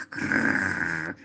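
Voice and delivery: low rolled grrr; stern growl. Acted. Boy mimicking the cross teacher, low stern growl. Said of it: "assumendo un'espressione arcigna".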